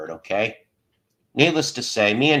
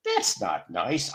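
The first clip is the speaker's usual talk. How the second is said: low, slow, soft hurt reply; mock-wounded little complaint; quietly hurt; 6 semitones lower, 7 dB quieter, slower than usual. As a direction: sound wounded, dragging the words out softly. Acts hurt by the insult.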